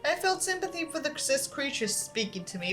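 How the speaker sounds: steady storytelling read; story narration. Acted. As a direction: read the tale to the audience. Reads a horror story aloud.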